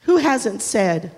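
ordinary delivery

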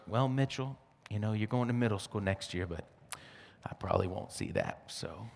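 quiet mock grandma sigh; grandmother's voice. Grandmother voiced in retold story.

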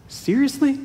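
high, incredulous "Seriously?"; shocked toddler-like whine; stunned disbelief. Acted. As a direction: leap way up in pitch, stunned. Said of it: "Jonah, stunned, says".

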